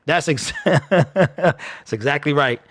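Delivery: chuckling through the words; slow, amused agreement with a laugh. Real, not acted. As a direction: laugh while agreeing, slowing down.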